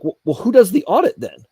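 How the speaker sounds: pressing the official hard for an answer; incredulous retold question; exasperated demand. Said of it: "I'm like".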